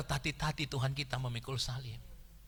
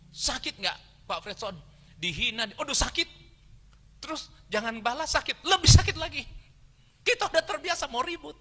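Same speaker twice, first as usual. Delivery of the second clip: high, loud sermon voice; impassioned preaching; 9 dB louder than usual. Real, not acted. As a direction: drive it home at full force, pitched high.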